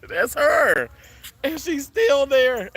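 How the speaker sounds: high, loud, slowing happy cry; tearful joy. Real, not acted. Vlogger sees the bird still there, tearful joy. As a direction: cry it out between bursts of tearful laughter.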